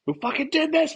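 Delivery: high, loud enraged yell; mock rage, shouted high and loud; furious shout. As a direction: demand it at the top of your voice. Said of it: "Just freaking out".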